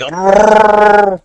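high put-on Mexican-accented growl; a sleazy Mexican Chewbacca hitting on a woman; hammed-up high comic pickup voice. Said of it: "I could do it".

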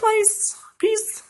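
high squeak on please and Peace; cartoonish high-pitched peace; squeaky joke voice. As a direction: squeak it out for the pun. Punning on Conversation Peace book.

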